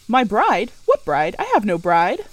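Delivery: loud, incredulous outburst; loud denial. Acted. Rochester loudly denies having a bride to Jane. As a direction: fling the word bride back at her.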